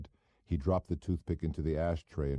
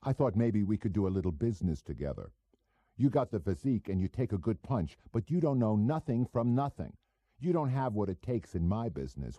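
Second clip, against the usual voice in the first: a hint of a cocky small-time operator; 5 semitones higher than usual. Goebel voiced in dialogue.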